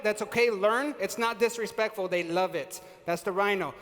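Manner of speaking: ordinary delivery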